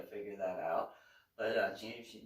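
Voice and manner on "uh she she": usual speaking voice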